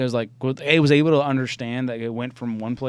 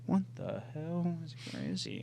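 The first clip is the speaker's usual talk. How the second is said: hushed mock puzzlement; pretend searching; 4 semitones higher, 12 dB quieter than usual. Dog owner in a new hiding spot, hushed pretend searching. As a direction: wonder softly under your breath.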